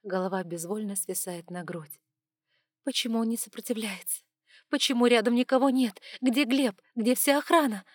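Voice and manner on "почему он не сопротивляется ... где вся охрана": panicked. A narrator's reading. Panicked questions in nightmare.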